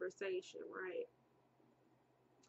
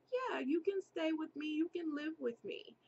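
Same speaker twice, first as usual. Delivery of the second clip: lightly voiced eager stranger woman, higher; a hint of a too-sweet stranger; mild higher coaxing woman; eager lady; 6 semitones higher than usual.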